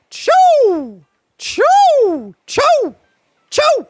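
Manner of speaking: piercing high attack yell, very loud; fighting game character attack shout; Assassin attack cry. Retold or imitated. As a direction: spit out sharp high choo cries. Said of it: "he would go".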